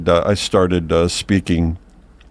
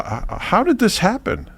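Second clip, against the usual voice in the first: baffled, higher-pitched question with a stumbled start; amazed questioning; 12 semitones higher than usual. Acted. Man to the talking dog he just met, amazed. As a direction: ask it in stammering amazement.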